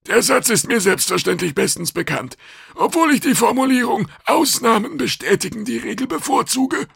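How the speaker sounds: very high, loud creature voice; pedantic alien official. A narrator's reading. Customs officer debating the rule, high and pedantic.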